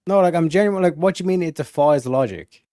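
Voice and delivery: ordinary talk, nothing marked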